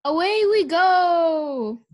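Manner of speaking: loud, long drawn-out holler; high, slow call to ride off; cowboy shout. A narrator's reading. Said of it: "shouted Leroy Ninker".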